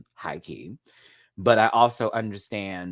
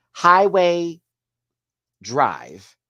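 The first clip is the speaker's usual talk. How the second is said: ordinary talk, nothing marked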